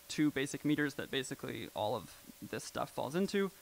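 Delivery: normal talking voice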